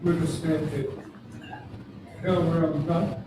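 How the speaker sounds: normal talking voice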